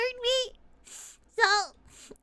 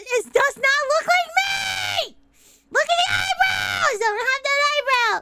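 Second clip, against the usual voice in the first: mock outrage; 3 semitones higher, 4 dB louder than usual. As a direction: protest loudly as if deeply offended. Outraged protest at fan picture.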